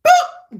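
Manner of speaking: high, loud popped "boop"; sudden "boop". Acted. Showing how fast she leaves her ex, high sudden boop. Mimes leaving instantly.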